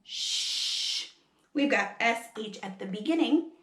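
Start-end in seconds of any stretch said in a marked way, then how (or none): 0.0-1.1 "Shh" as a long voiceless hush; letter-sound demo. Shown as a demonstration. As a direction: hold out "Shh" as pure air. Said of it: "so what do they say?".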